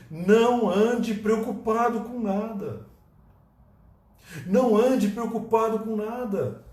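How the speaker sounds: high, slow, drawn-out exhortation; preacher pressing a point home; slow emphatic preaching. Acted. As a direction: stretch every word like a command. Preached quote, slowed.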